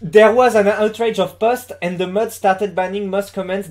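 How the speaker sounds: slow, deliberate English with a thick French accent; basic French accent. Shown as a demonstration. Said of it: "je tente l'accent français de base". (accent français de base en anglais)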